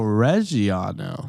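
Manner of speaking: put-on Italian accent. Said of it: "I like that accent that you put on".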